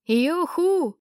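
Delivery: wild yell. A narrator's reading. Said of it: "дикий вопль".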